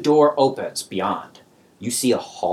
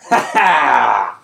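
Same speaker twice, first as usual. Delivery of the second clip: mock goblin laugh; 3 semitones higher, 7 dB louder than usual.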